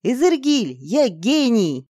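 drawn-out, gloating exclamation; triumphant yell. Acted. Girl boasting to Izergil, exultant. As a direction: crow over your own genius. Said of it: "заорала я".

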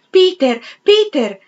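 loud, high-pitched call after someone; crying out. A narrator's reading. Clarissa runs after Peter on the landing, shouting. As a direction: call out urgently to stop someone leaving. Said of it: "gridò Clarissa correndogli dietro sul pianerottolo".

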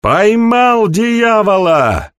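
high, slow triumphant yell; shouting. Acted. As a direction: call out into the dark. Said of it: "крикнул кто-то из темноты".

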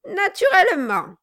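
high, loud irritated echo; testy mocking repeat, falling sharply; ill-humoured mimicry. A narrator's reading. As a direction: throw NATURELLEMENT back, pitched high. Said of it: "répéta le nain avec humeur".

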